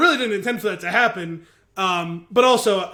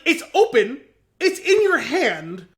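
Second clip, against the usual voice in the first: slow exasperated; 7 semitones higher, slower than usual. Retold or imitated. Retold exasperation at brother.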